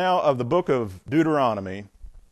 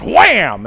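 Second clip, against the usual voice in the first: loud punchy wham; mock impact sound; 2 semitones higher, 11 dB louder than usual. Preacher voicing the rock being hit, loud burst. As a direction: smack the word like a stick on stone. Narrating Moses hitting the rock.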